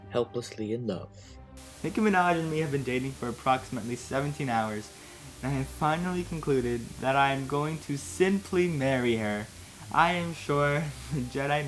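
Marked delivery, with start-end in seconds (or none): none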